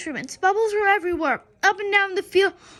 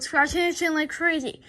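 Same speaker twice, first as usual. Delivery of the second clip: ordinary delivery, nothing marked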